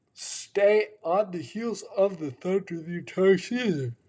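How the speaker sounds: talking through a yawn; words stretched by a yawn. Real, not acted. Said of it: "Sorry for the yawns".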